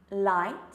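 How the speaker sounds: "light" loud, with an open L and the tongue coming down; clear L. Shown as a demonstration. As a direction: open the mouth wide on the L of "light". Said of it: "so that's the L".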